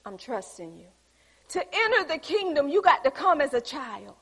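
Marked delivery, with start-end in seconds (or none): 0.0-0.9 quiet surrender. Pretend. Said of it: "and say".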